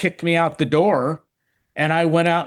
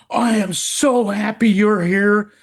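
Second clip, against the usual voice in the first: overjoyed greeting; 6 semitones higher than usual. Retold or imitated. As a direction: let the happiness spill out slowly. Friend overjoyed meeting him outside Walmart.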